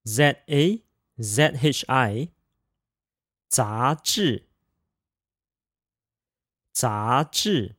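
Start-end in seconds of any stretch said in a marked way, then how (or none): 3.5-7.8 杂志 said zá zhì, rising then falling; 杂志 with second tone then fourth tone; practicing tones. Shown as a demonstration. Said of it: "second tone, fourth tone pair".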